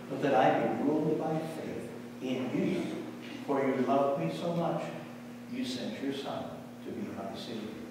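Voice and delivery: put-on soft, measured prayer lines for children to repeat; children's prayer. Pastor leading children in a repeat-after-me prayer, soft and measured. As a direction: lead the children phrase by phrase.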